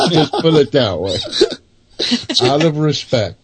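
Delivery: laughing. Real, not acted. Guest dodging the host's question, laughing. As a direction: chuckle it out sheepishly.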